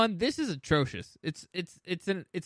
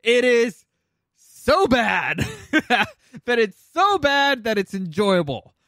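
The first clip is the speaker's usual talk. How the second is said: excited; 6 semitones higher, 6 dB louder, slower than usual. Real, not acted. Laughing enthusiasm about bad movie.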